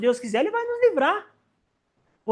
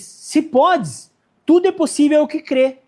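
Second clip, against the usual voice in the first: louder, rising to a firm declaration; emphatic Jesus; 4 semitones higher, 5 dB louder, slower than usual. Retold or imitated. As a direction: proclaim it with authority. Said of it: "E ele diz". (Jesus proclamando com força)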